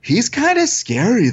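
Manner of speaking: high-pitched imitation of an awestruck boy; dazed nephew. Nephew after meeting his idol, high dazed awe. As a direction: stretch it out high, in dazed wonder. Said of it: "he's such in a daze".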